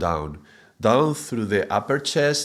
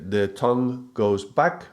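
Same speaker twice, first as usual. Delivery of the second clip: usual speaking voice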